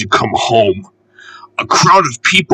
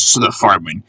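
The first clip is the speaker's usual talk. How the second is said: plain reading voice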